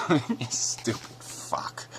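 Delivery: hissed under the breath, unvoiced; whispered insult. Real, not acted. Muttering at the screen, a whispered insult. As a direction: whisper a contemptuous insult to yourself.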